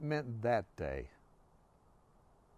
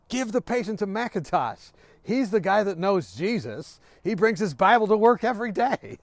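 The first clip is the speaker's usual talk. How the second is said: raised, higher-pitched mock call; higher, louder shout from the background; nurses calling out; 5 semitones higher, 5 dB louder than usual. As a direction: call it out across a busy ward.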